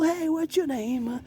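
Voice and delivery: very high, slow and soft spooky voice; hushed ghost voice. Pretend. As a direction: coo it slowly like a tiny ghost. High voice greeting ghost decorations.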